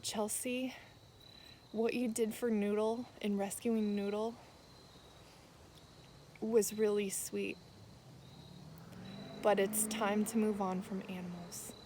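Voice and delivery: softly retelling a holy whisper; God's hushed words. Retold or imitated. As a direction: speak it gently, as if God is whispering to her.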